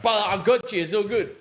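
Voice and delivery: high, loud voice of a gym bro rushing in; urgent reassurance. Retold or imitated. Gym stranger running over to save a failed bench, urgent reassurance.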